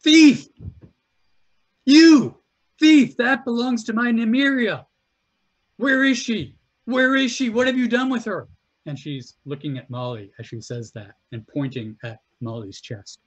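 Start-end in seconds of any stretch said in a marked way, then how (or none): 0.0-8.5 shrill accusing shout, high and loud; frantic refugee woman yelling thief. Acted. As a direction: scream it at the thief. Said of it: "and shouts".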